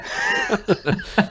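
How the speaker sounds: cracking up in a high squeal; high laugh. Real, not acted. Laughing at partner's intro.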